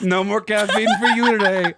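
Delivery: high student voice through laughter; imitated scolding kids. Students banning teacher's caffeine, mock scolding.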